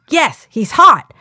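slow, loud mock shout. Co-host reading a viewer's aside, slow loud shout. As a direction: shout it out slowly and teasing. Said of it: "Then it was just like".

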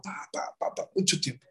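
whispered running rhythm; soft whispered tapping sounds; whispered beat. Retold or imitated. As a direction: breathe the pa pa like footsteps. Training montage sound.

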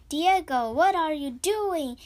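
loud, angry shout across the room; loud angry cry at Diego; angry call. A narrator's reading. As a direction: call him out sharply. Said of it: "He sounded angry".

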